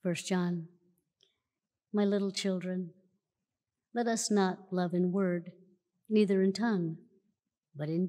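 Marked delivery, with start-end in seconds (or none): none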